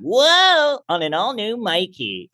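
high whoa into a mock promo; hammed-up kids' TV announcer; kids' show promo.

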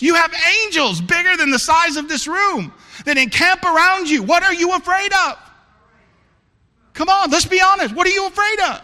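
high, loud, fervent sermon preaching; preaching at full force. Acted. Pastor challenging his church family, preaching at full force. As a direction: drive it home to the congregation.

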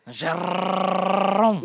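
"Jérôme" with a long, loud trilled R; hammed-up rolling R in "Jérôme"; over-rolled French R. Shown as a demonstration. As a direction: vibrate the R in "Jérôme" far too long. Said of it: "you don't vibrate your".

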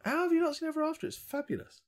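high, rushed disbelief; astonished. Real, not acted. Film fan urging the co-host, astonished. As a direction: protest it in high-pitched amazement. Shocked co-host has not seen it.

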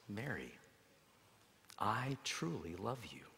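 almost a whisper of love; Jesus answering Mary very softly; tender, hushed. Acted.

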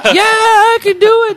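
high, bright mock-eager squeak; eager yes. Retelling his yes to the ad people in a high mock-eager voice.

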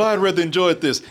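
ordinary talk

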